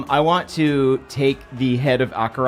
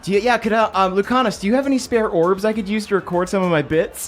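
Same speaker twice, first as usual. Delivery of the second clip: a touch higher, gushing character; lightly voiced delighted NPC; 7 semitones higher than usual. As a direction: play an eager fan, gushing.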